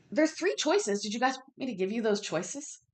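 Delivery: ordinary talk, nothing marked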